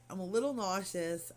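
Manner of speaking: ordinary talk, nothing marked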